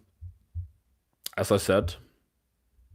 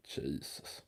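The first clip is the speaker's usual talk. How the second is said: whispered jesus; 10 semitones lower, 21 dB quieter than usual. Real, not acted. Exasperated aside.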